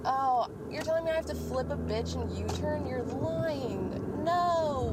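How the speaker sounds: real disbelief at having to U-turn; exasperated disbelief. Real, not acted. Told to U-turn by the road, exasperated disbelief.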